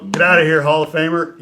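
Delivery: loud, high-pitched playful yell; mock dismissal. Pitcher retelling his banter with a Hall of Famer, loud mock dismissal. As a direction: shout it as a teasing brush-off. Retold teasing dismissal of Brett.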